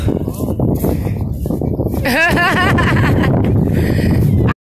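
long rising giggle; laughing. Real, not acted. Vlogger giggles after dodging a ball. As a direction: laugh through the nerves.

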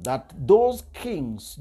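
ordinary talk

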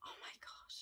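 breathless whispered awe; hushed, amazed whisper; awed whisper. Real, not acted. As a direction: whisper it, stunned by the colour. Awed at makeup.

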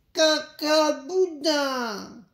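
high, loud, drawn-out cry; cheeky little rabbit. A narrator's reading. Little rabbit answering his mother, cheeky and high. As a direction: belt it out high in gleeful defiance.

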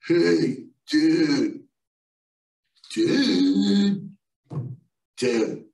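lightly voiced mellow hippie, drawn-out dudes; a hint of an old surfer hippie calling him down; laid-back hippie. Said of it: "this elderly hippie guy goes".

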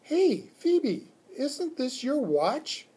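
put-on high-pitched girl flagging a discovery; high, eager schoolgirl question; excited child. A narrator's reading. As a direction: call out the find in a child's voice. Girl excitedly spots friend's watch.

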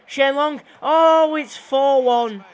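loud, high excited shout; goal celebration. Real, not acted. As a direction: erupt as the goal goes in. Goal scored in live commentary.

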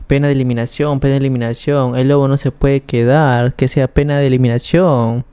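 ordinary delivery

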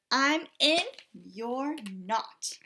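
ordinary delivery

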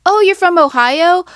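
high, eager "Oh, you're from Ohio?"; light up with curiosity; interested reply. Shown as a demonstration. Vlogger modeling a curious reply to Ohio, eager. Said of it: "No, you're just talking to talk".

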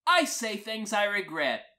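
ordinary delivery, nothing marked

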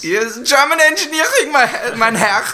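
hammed-up high German accent, laughing; exaggerated German accent, high and loud. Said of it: "how's my offensive German accent".

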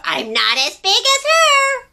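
very high, loud squeaky tiny dinosaur; a tiny creature proudly calling out; little creature. Acted. Reader voicing little scuttling dinosaur answering Pipkin. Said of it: "said the dinosaur".

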